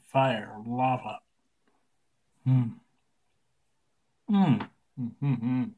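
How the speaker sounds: talking around candy; sucking while speaking; lollipop in mouth. Real, not acted. Seen 2: Lollipop stick visible in mouth throughout the labelled words.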